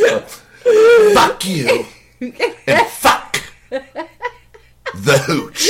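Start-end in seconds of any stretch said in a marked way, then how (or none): 1.1-5.7 retold curse broken up by laughter; very slow mock retort, struggling through laughs; retold snap. Host repeats the victim's response, laughing through the snap. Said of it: "his response was".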